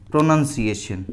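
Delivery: प्रोनंसिएशन as pro-nun-see-ay-shun; short "nun" in the middle of प्रोनंसिएशन, no "nown"; correct pronunciation. Shown as a demonstration. Teacher giving students the correct word, careful pronunciation. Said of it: "इसका सही है".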